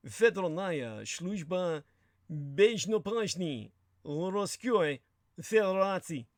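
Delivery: "Federalnaya Sluzhba Bezhnopashny Rosskyoi Federatsy" spelled out slowly in mock Russian; Russian pronunciation. Shown as a demonstration. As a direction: sound out the Russian agency title with relish. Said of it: "the Russian Federal Security Service".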